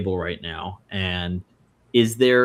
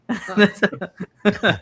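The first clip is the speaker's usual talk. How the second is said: a high, loud laugh that breaks out; bright burst of laughter; 11 semitones higher, 5 dB louder than usual. Real, not acted. Laughing at his own quote.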